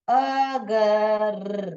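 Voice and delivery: "अगर" drawn out very slowly, sound by sound; very slow reading. Shown as a demonstration. Teacher reading a student's typed word "अगर" very slowly. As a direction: stretch "अगर" as if decoding it. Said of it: "आपको कैसे मालूम पड़ा?".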